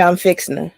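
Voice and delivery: "fixing to" squeezed into "fixna"; Southern phrasing. Retold or imitated. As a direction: run "fixing to" together as "fixna". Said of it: "you in the South when you hear".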